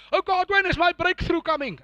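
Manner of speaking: high-pitched mock pleading. Preacher mimicking prayers he can't pray, high mock pleading. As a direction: put on a needy believer's high whine. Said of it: "I can't pray that kind of prayer".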